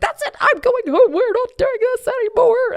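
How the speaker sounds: very high, loud storming-off voice; angry sister. Retold or imitated. Angry sister announcing she's going home, shrill. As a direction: quit in a rage.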